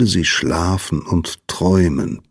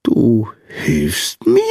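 slow, squeaky hopeful question; touched, delighted question; 3 semitones higher, much slower than usual. A narrator's reading. Sandman surprised that Lina will help, slow delighted question. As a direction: draw it out in happy disbelief. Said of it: "fragte der Sandmann beglückt".

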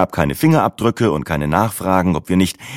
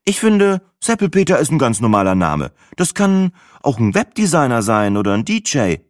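higher, breezy and unbothered; Sina, young woman; 6 semitones higher than usual. A narrator's reading. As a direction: Sina shrugging it off. Narrator voices Sina.

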